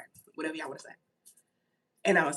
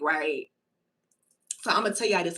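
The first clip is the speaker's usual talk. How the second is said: ordinary delivery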